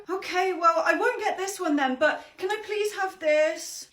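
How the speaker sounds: put-on high, whiny little girl; child voice. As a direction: play a pleading ten-year-old. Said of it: "ten-year-old".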